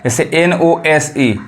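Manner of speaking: ordinary talk, nothing marked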